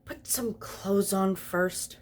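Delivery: slow prim objection; objecting. Acted. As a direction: lay down the demand, slowly. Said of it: "Aziraphale objected".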